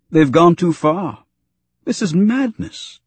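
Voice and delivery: pitched high, slow and appalled; dismayed protest. A narrator's reading.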